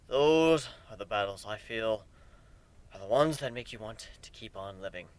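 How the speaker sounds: put-on an old warrior musing quietly after battle; low-voiced griffin. Griffin Aoi speaks, low.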